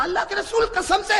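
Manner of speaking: high, loud, laughing urgency; excited playful urging. Acted. Aisha urging the Prophet to run too, excited playful shout. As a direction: hurry him along in play.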